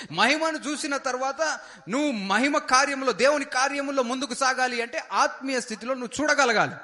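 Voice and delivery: high, loud exhortation from the pulpit; rally the congregation with full-voiced fervour; fervent preaching. Pastor exhorting the congregation to believe, loud fervent preaching.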